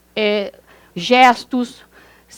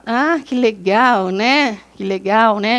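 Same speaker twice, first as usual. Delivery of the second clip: ironic; much slower than usual. Shown as a demonstration. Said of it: "as ironias".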